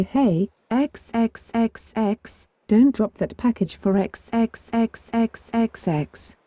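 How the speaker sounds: slow, easy call to the mailer; slow, relaxed instruction to the mailer; casual phone request. Retold or imitated. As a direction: spell out the request slowly, like on the phone. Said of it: "I call her and say".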